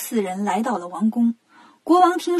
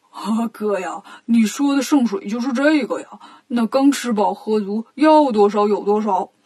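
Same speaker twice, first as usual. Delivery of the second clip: lightly oafish sidekick; 3 semitones lower than usual. Acted. 八戒看懂了圣水是什么, put-on comic voice. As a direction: play the sidekick who has just caught on. Bajie catches on, character voice.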